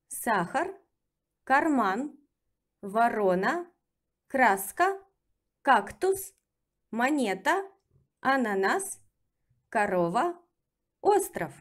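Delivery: brisk natural-pace repeat; second reading. Shown as a demonstration. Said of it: "let's practice with reading".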